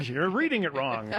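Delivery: slow, hammed-up actor line; thespian voice. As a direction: overplay it like a stage actor. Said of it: "I like the thespian voice there".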